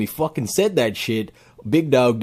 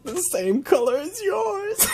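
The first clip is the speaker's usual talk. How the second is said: slow, much higher, sweet-talking; mock flirty high voice. Flirting with the arrestee on video, a high mock coo. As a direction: coo it like a lovestruck fan.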